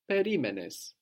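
ordinary talk, nothing marked

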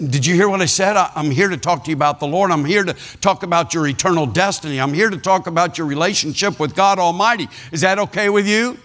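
pushy preaching. Retold or imitated. Preacher pressing Willard in retold scene.

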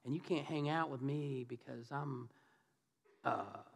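low, soft, halting awkward excuse; sheepish, hesitant mumbled excuse; awkward brush-off. Acted. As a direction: fumble an uncomfortable brush-off to an old friend. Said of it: "can you imagine how awkward that was?".

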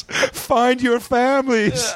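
high, drawn-out theatrical command; hammed-up send-off with long vowels; mock dramatic farewell. Mock owner sending cats off, grand slow farewell.

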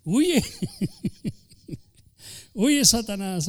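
commanding rebuke with a laugh; rebuking Satan. Acted. Preacher ordering Satan to flee, commanding. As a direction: cast the devil out.

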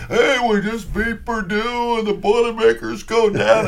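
high, drawn-out gloating broadcast call; radio announcer. Retold or imitated. Rival announcer rolls on about beating Purdue, high mock broadcast voice. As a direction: crow the upset to the listeners, imitating an announcer. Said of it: "he's just rolling about".

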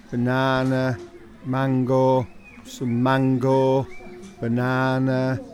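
droning, monotone trance; mesmerised. Acted. Commentator mesmerised by a bowl of fruit, entranced drone. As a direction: intone each word slowly on one note, hypnotised. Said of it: "I got mesmerised there".

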